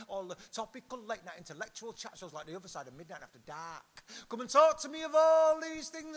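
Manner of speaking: rhythmic, declaimed performance poetry; poem recitation. Acted. Poet calling on listeners to talk of Manchester, rhythmic recitation. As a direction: declaim it in rolling lists, each refrain rising to a peak.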